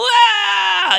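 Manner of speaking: high, loud mock cheer; mock excited yell. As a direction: let out a hammed-up shriek. Mocks an overexcited bill email.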